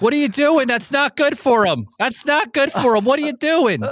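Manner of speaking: high, alarmed mother scolding; mother scolding grandma over the whiskey. Retold or imitated. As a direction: imitate a fussing mom, high and urgent. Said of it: "my mother would be like".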